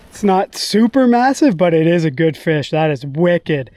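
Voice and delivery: ordinary talk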